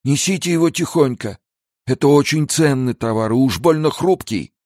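put-on high, fretful old trader; anxious pleading. Old man warns guards about fragile goods, anxious pleading. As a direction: fuss over precious cargo, voice way up.

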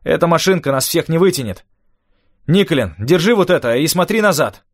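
high, energetic commanding voice; giving orders. A narrator's reading. Rafael directing passengers on the little cart, commanding. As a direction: call out instructions to the crew. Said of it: "велел Рафаэль".

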